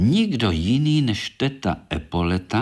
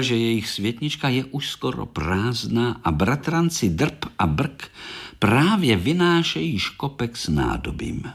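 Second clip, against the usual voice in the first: plain reading voice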